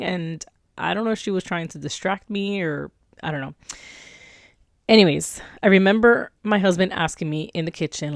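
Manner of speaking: ordinary talk, nothing marked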